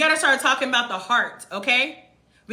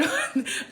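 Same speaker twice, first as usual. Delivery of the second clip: high laugh; 12 semitones higher, 5 dB quieter than usual. Real, not acted. Laughs mid-sentence.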